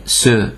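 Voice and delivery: sző as French ce, with an S sound; soft C. Shown as a demonstration. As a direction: say sző like "suh", lips rounded. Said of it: "néha C-nek ejtjük".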